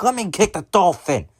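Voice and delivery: put-on high-pitched child's demand; mock bratty kid voice; bratty little kid. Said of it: "And the kid's like".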